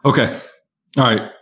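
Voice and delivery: breathless after laughing; winded from laughing; after laughing hard. Real, not acted. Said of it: "I'm crying".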